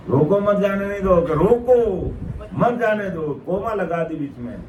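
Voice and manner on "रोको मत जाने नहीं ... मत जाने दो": raised-pitch acted order to stop; calling out. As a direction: call after people to halt them. Acts gods stopping departing souls. (ऊँचे सुर में रोकने की पुकार)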